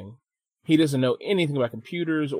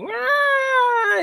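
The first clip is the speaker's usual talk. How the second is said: high-pitched mock child's squeal; imitated kid's wail; 4 dB louder than usual. Mimicking the kid being yelled at, high mock wail. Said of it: "the kid's like".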